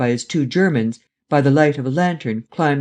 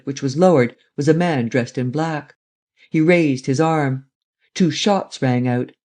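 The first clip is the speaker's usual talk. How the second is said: ordinary delivery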